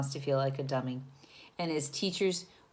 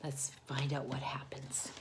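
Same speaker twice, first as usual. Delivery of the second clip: hushed, secretive whisper; 4 semitones lower, 10 dB quieter than usual. Acted. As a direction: lean in and whisper to the kids.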